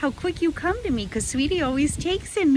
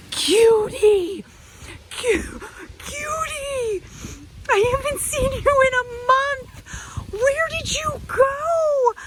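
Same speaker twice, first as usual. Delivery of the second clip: much higher, crying with happiness at the reunion; tearful joy; 13 semitones higher, slower than usual. Real, not acted. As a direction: choke up with joy at a friend come back. Reunion with lost squirrel.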